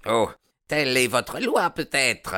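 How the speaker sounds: high-pitched, resentful retort; indignant challenge. A narrator's reading. Baldwin challenging the chief's law, indignant. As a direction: bristle at the ruling, pitch up.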